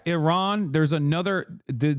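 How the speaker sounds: usual speaking voice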